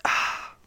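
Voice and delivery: breathy "ah" after a sip; satisfied sigh. Shown as a demonstration. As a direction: let out a refreshed sigh after drinking. Demonstrates a beer sip for listeners.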